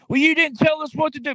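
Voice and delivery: high whiny mock voice of the public; hammed-up high complaining voice; mocking complainers. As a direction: whine it like a blaming crowd. Mocking people blaming president.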